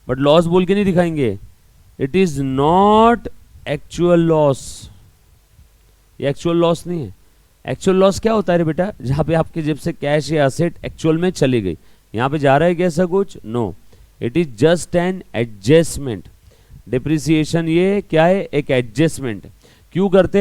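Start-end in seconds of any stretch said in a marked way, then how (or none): 2.0-4.6 slow dictation. Shown as a demonstration. Teacher reading the textbook definition aloud for notes, slow. 13.4-16.3 slow it right down to drive it home; slow emphatic point. Shown as a demonstration. Teacher driving home that depreciation is an adjustment, slow.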